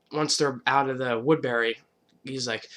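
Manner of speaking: ordinary delivery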